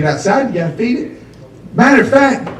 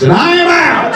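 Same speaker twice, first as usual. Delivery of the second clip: much higher shout; mock panic shout; 11 semitones higher than usual. To the audience fleeing the horse idea, high shout. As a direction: bolt out with a yelp.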